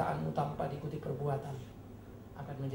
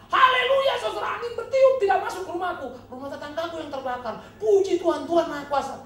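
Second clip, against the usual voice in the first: shouted high, laughing mock hallelujah; mock jubilant praise; 11 dB louder than usual. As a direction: crow it like a smug believer. Mocking a believer's praise.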